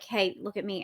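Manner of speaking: low, soft attempt at drawing notice; attention seeker, subdued. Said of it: "We're like".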